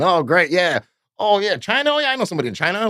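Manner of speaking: lightly voiced careless owner; mock Rick voice. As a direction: play the distracted owner brushing off staff. Voicing the owner's distracted replies.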